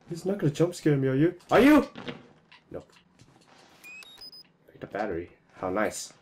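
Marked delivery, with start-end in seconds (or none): 0.1-1.9 nervous rising high pitch; anxious, bracing for a jump scare; scared horror game streamer. Real, not acted.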